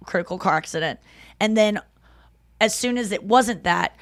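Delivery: ordinary talk, nothing marked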